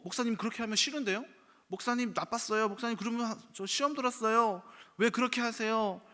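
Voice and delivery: high, pleading complaint of a hurt church member; whiny congregant complaint. Retold or imitated. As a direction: whine up high like an offended believer. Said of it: "라는 말을 들을지라도".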